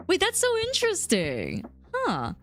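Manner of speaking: high-pitched, delighted-to-learn surprise; real surprise. Real, not acted. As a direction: react with amazed curiosity, pitch shooting up. Surprised by chat message.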